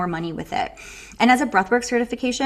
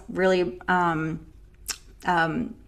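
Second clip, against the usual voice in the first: eating while talking; 3 semitones lower, 4 dB quieter, slower than usual. Real, not acted. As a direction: mouth full of food. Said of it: "sorry guys, I'm eating".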